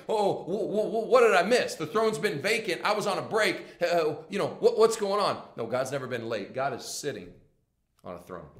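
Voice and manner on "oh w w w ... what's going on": higher, stammering mock confusion; flustered latecomer. Preacher mocking a king coming late to the throne, flustered. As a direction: play someone caught off guard, stumbling over the questions.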